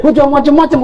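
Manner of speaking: threatening jinn. Retold or imitated.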